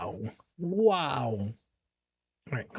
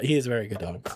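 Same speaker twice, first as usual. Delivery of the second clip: tender praise; 8 semitones lower, much faster than usual. Acted. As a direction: coo it to a good boy. Praising the dog twice.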